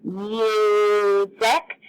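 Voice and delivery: "Music" with a lengthened U; muuu-sic, first syllable of "Music" drawn out; stretched-out vowel. Shown as a demonstration. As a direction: hold out the U of "Music", then add sic. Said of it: "Because I held out that U".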